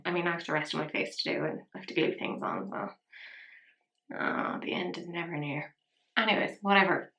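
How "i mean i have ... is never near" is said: muttered aside. Real, not acted. Tired aside to viewers.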